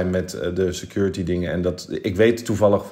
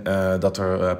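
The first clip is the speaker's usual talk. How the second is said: ordinary talk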